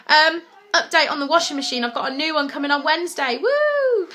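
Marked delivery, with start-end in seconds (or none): 3.4-4.1 high, cheering whoop; sudden high excited cheer. Real, not acted. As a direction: celebrate the delivery news. News of new washing machine.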